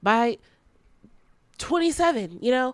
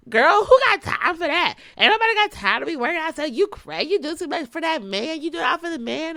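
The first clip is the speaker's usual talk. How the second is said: higher, louder sassy friend, a bit fast at first; mock judging friend; 8 semitones higher, 4 dB louder than usual. Friend mocking your workout habit, sassy raised voice. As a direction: play the nosy friend who thinks you're crazy.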